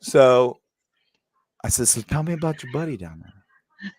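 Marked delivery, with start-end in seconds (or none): 1.9-3.9 hushed conspiratorial whisper; mock whisper. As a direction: lean in and whisper it. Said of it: "repeated question, whispered".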